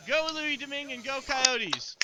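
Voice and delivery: loud excited cheer; rally the fans, high and loud. Real, not acted. Cheering on the team.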